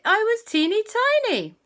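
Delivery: put-on high, squeaky "teeny tiny"; little voice, first go. Shown as a demonstration. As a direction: squeak it small. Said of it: "or rather".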